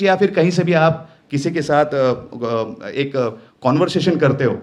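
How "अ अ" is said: ordinary talk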